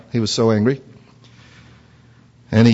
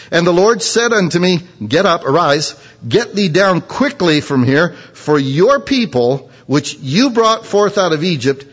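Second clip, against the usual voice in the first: measured, raised preaching read; scripture reading; 5 semitones higher than usual. Acted. Preacher reading God's words to the congregation, raised and measured. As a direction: lift the verses to the congregation.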